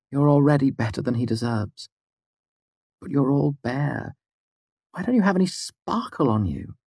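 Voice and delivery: high bright teasing woman's voice; playful teasing woman. Acted. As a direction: tease her fondly.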